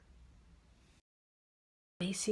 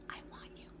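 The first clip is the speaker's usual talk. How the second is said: intimate whisper, barely audible; 17 dB quieter, faster than usual. Shown as a demonstration. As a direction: whisper it into his ear. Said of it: "Whisper it".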